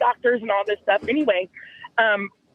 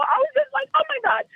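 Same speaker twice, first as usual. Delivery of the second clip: very high-pitched swoon; gushing; faster than usual. Retold or imitated. Woman recalls her new love's walk, high gushing exclamation. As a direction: gush it way up high. Swooning over how he walked.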